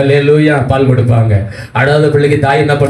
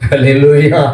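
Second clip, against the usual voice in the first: usual speaking voice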